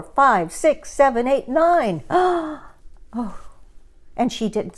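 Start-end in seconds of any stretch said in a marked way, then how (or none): 2.1-2.5 greedy gasp. A narrator's reading. Gertrude gobbling berries.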